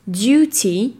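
British duty; "duty" with a Y sound and a clear T, "dyootee"; British English. Shown as a demonstration. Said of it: "On te le répète encore une fois". (en anglais britannique, "duty" avec un son Y et un T net)

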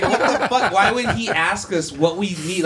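high, baffled question with a laugh in it; retold bafflement. Electrician recalls wondering why the shop guy asks, baffled and laughing.

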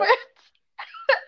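a high, loud burst of laughter. Real, not acted. Laughing with the host, a high loud laugh. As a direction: break into a high laugh mid-word. Laughing after host's remark.

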